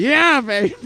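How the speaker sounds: high, loud gleeful catchphrase; Austin Powers impression. Host celebrates the Austin Powers story, high loud impression. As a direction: belt it out as a high, cheeky movie-spy cheer.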